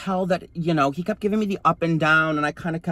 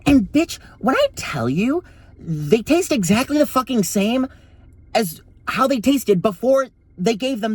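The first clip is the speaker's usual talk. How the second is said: exasperated outburst; 6 semitones higher, 3 dB louder than usual. Real, not acted. Outraged punchline to viewers.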